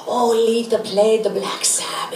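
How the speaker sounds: lightly imitated Italian accent, laughing; a hint of Mom's Roman accent, giggling through it; Italian mother's accent, lightly done. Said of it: "Mother's accent".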